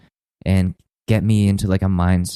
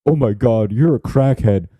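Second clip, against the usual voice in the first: mock shocked critic; 3 semitones higher than usual. Critics reacting to his drug use, mock shocked. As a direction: imitate an appalled onlooker. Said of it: "they're just like".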